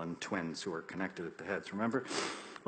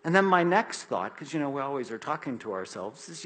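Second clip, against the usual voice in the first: usual speaking voice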